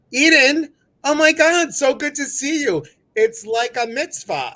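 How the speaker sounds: very high, loud delighted greeting; excited squeal. Real, not acted. Host thrilled to greet a friend on the live, shrieking with joy.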